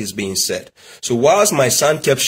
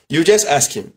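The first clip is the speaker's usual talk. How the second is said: usual speaking voice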